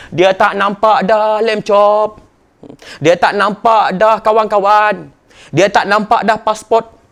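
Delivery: slow, weighty preaching line; slow emphasis. Acted. Ustaz stressing to students what no longer mattered, slowly. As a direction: lay each word down slowly.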